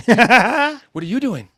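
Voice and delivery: slow stunned what; drawn-out disbelief; incredulous. Acted. In character, stunned.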